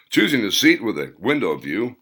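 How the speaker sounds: ordinary delivery, nothing marked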